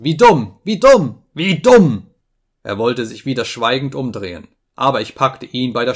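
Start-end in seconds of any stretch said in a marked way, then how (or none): 0.0-2.1 loud, high exclamations of scorn; shouted, exasperated insult; furious repetition. A narrator's reading. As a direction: hammer out each repeat in rage. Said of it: "schrie ich, vor Zorn zitternd".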